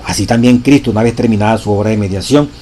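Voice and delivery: loud, solemn reading of a sacred text; preacher reading scripture with force; reading a quotation aloud. Acted. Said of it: "Oigan esto".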